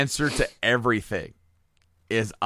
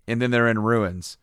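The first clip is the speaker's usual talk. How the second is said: ordinary talk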